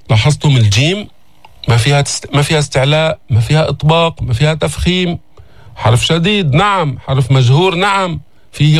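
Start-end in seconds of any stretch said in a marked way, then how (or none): none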